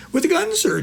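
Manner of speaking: high, eager piping voice. Retold or imitated. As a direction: pipe up high like a nervous kid. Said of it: "he pipes up".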